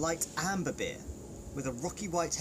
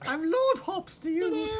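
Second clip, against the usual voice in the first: high, louder snooty lord; posh lord voice; 6 dB louder than usual. Pretend. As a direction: play a haughty aristocrat. Voicing Lord Hops.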